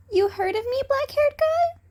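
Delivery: put-on high, loud, cheeky young voice; cocky cat boy. A narrator's reading. Cat boy teases Eraserhead, high and cheeky.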